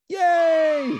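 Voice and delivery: high, loud cheer; happy shout. Shown as a demonstration. Storyteller models the happy response, shouting. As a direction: shout a long joyful cheer. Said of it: "and shout".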